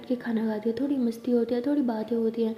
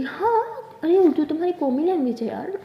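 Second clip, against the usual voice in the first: high-pitched, emphatic retort; lively indignant correction; exclaimed protest; 5 semitones higher, 4 dB louder than usual. Acted. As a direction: leap up on the yes, then protest. Protests at the surprised question.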